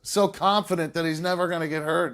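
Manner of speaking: mock him as the guy who jinxed himself; mocking commentator voice. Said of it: "they're just gonna use this clip and be like".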